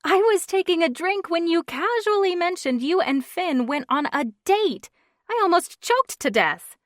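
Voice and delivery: lightly voiced chatty friend; flustered friend on the phone; Stephanie, chatty friend.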